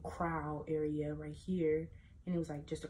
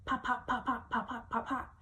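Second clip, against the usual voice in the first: popping noises; 6 semitones higher than usual. Retold or imitated. Imitating firecracker pops.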